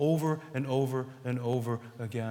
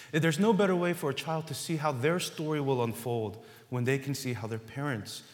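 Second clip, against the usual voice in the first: usual speaking voice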